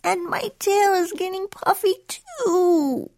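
high, slow, whiny kitten wail; groaning complaint. A narrator's reading. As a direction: whine it out slowly, feeling sorry for yourself. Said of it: "he groaned".

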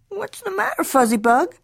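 high-pitched motherly question; concerned mother. A narrator's reading. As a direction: ask like a mama checking on her kitten. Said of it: "asked Mama June Bug Jones".

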